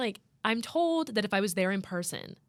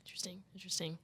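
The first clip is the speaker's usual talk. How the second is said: whispering; 12 dB quieter, much faster than usual. Real, not acted. Musing whisper.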